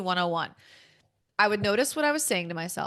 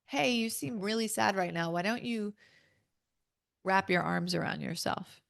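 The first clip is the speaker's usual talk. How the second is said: soft and tender, much quieter; a quiet, caring inner voice offering comfort; gentle intuitive voice; 6 dB quieter than usual. Retold or imitated. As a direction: soothe yourself like a kind inner guide. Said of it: "So she would say things like".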